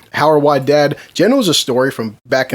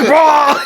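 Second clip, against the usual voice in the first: loud imitated retch; high, explosive fake puking noise; mock projectile vomit; 11 semitones higher, 3 dB louder than usual. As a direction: imitate a sudden heave of vomit. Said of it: "starts fucking projectile vomiting".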